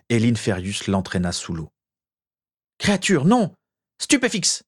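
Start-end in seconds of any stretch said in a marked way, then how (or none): 2.8-4.6 alarmed shout. A narrator's reading. Wizard sees his elf dragged under, high alarmed shout. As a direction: yell for the elf, then fire the curse.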